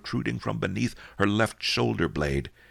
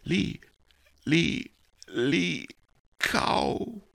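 dying gasp; 9 semitones higher, much slower than usual. Acted. Dying woman gasping words.

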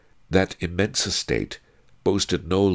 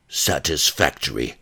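put-on grunted by a huge old woman; grunted appraisal. A narrator's reading. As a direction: grunt it out approvingly. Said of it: "she grunted".

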